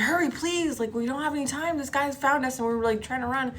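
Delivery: high, urgent begging; panicked pleading. Retold or imitated. Friend begs police to hurry as they flee, panicked pleading. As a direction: imitate a frightened caller pleading for help.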